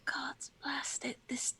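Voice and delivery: slow, hushed whisper of shock; whispered curse. Acted. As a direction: breathe the curse out in disbelief. Character sees her own body.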